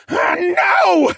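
high, loud shout of protest; desperate scream. Acted. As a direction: cry out in furious denial. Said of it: "Cad screamed".

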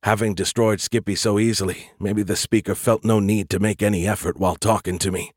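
plain reading voice

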